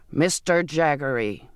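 slow, drawn-out call; understated ragged voice. A narrator's reading. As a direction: call the name out slowly, a touch ragged. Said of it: "his voice ragged".

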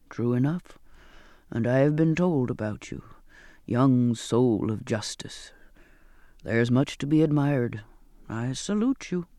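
put-on low, calm old sailor voice; old sailor Zachariah. A narrator's reading.

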